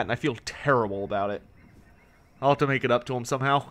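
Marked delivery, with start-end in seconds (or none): 2.4-3.6 rushed, very fast speech. Real, not acted. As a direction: rattle it off at top speed. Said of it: "You sound like a madman on one point seven five speed".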